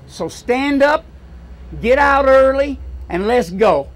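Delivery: loud, high rally cry; loud, high push to get out and vote; rallying call. Real, not acted. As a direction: fire the crowd up. Rallying the crowd to act.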